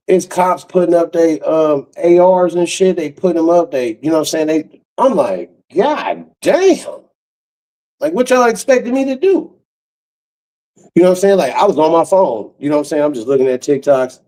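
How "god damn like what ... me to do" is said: high-pitched, incredulous protest; retold astonishment pitched way up; exasperated disbelief. Man facing cops' rifles, high exasperated disbelief. Retelling shock at raised guns.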